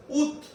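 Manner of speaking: "Ut" short and high, like "oot"; fast pronunciation. Shown as a demonstration. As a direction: snap out "Ut" as a quick "oot". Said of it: "when the pronunciation is very fast".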